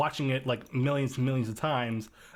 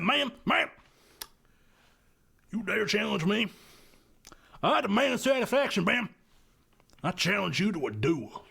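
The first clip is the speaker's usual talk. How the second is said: Southern gentleman; 7 semitones higher than usual. Acted. Playing a blustering Southern gent for the host, high and pompous. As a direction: challenge her to a duel with mock outrage. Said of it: "I'm getting a Foghorn Leghorn vibe".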